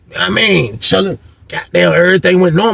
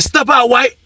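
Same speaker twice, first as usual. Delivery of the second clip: lightly voiced, higher-pitched order; a short, raised mock command; imitated officer command; 7 semitones higher, slower than usual. Officer orders inmates from cells, lightly mocked command.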